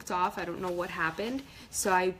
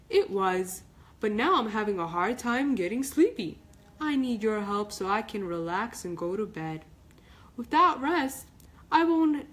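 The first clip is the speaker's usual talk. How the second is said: pleading sun; 3 semitones higher, 4 dB louder than usual. Acted. Mister Sun begging Melanie to help him sleep.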